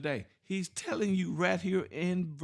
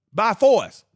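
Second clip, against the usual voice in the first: forceful emphasis; 10 dB louder than usual. Real, not acted. Preaching about being forced.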